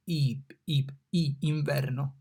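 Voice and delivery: ip, ip with ee like deep; ee, inverno; drilling the ee vowel; Italian I as ee. Shown as a demonstration. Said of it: "like double E in deep".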